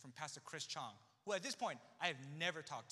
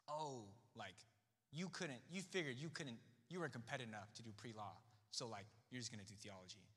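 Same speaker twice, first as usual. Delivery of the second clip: softer, lower, sinking voice; quiet judgement; 5 semitones lower, 5 dB quieter than usual. Retold or imitated. School friends doubting his competence, subdued judgement. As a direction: imitate friends letting him down quietly.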